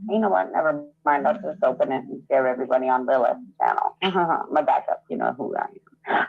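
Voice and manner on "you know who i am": ordinary talk, nothing marked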